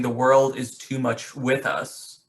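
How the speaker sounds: "The world is too much with us" read slowly, weight on "world"; slow quoted line. Shown as a demonstration. Teacher quoting the poem's opening line, slow and weighted. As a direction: draw out "world" and let the line fall. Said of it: "he starts the poem by saying".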